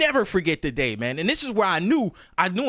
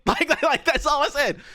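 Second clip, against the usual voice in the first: high-pitched laugh breaking into words; laughing disbelief; 9 semitones higher than usual. Real, not acted. Telling the chat about the fight, laughing in disbelief. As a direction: laugh it out, can't believe it.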